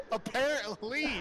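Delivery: high, slow laughing exclamation. Real, not acted. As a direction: laugh the word out. Laughing reply during squabble.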